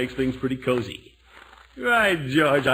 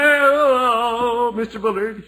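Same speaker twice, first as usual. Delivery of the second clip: sheepish greeting; 10 semitones higher, 6 dB louder, much slower than usual. Acted. Greeting angry neighbor sheepishly.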